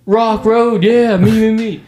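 joking that he'd give his own address too, goofy high voice; mock silly voice. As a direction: drag it out like a cartoonish wannabe tough guy. Jokingly imitates himself joining in.

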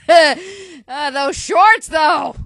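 loud giggling shriek over the shorts; fangirl shriek. Real, not acted. Gushing fan to viewers about the shorts, loud laughing shriek.

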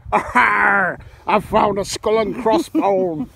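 high, loud pirate lady cry; hammed-up lady pirate exclaiming a find; husband retelling wife's pirate find, loud and high. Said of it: "a bit more pirate lady like".

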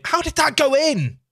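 high, loud shout of disbelief; real disbelief. Real, not acted. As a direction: yell it, stunned by the goal. Goal shock while streaming.

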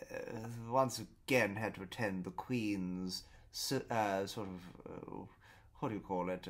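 lightly put-on posh English accent; a hint of a stuffy posh English gentleman; posh British accent. Posh neighbour meeting the couple, mild posh English accent. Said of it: "spoke a bit like what you Americans would imagine a British person would speak like".

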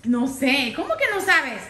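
loud, laughing scolding. Real, not acted. Owner loudly scolding her frantic dog, laughing.

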